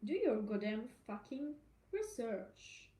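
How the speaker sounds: ordinary delivery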